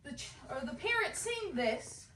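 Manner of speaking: ordinary talk, nothing marked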